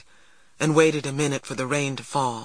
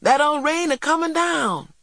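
joyful; 12 semitones higher than usual. A narrator's reading. Boy celebrating rain after fire.

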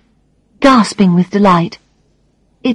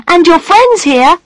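put-on high, slow boy's announcement; child announcing; 9 semitones higher, 3 dB louder than usual. A narrator's reading. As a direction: announce it like a small boy. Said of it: "Christopher announces".

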